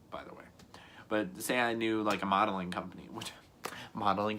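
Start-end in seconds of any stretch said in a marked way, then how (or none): 0.1-0.4 whisper. Real, not acted.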